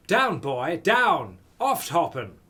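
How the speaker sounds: loud, barked mock German order; fake German command. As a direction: shout it like a German officer at a dog. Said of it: "Was that okay?".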